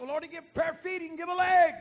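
high, fast, building to a shout; excited. Retold or imitated. Boy assuring granddaddy of a new leg, excited shout. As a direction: rush it out high, ending loud.

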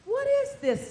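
high, startled cry of Satan; Satan's shocked cry. Acted. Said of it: "Satan said".

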